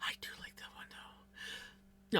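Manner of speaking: soft unvoiced whisper, slow; whispered aside. Real, not acted. Musing to herself about cheese in a whisper. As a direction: confide it under your breath.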